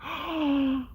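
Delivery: mimicked high gasp; gasping back at the lady; mocking gasp back. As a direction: mirror her gasp mockingly. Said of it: "looked back at her and went".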